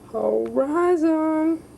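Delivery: very high, slow falsetto Horizon. Acted. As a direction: stretch it out way up high. Repeating hop name in voices.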